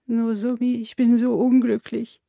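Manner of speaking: slow, acted whimper; drawn-out, tearful complaint; tearful outburst. A narrator's reading. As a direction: wail it miserably like a small child. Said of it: "stieß er unter Tränen hervor".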